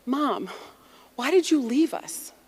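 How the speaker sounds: lightly acted hurt question to a mother; pleading child. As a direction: plead like a bewildered kid, retold.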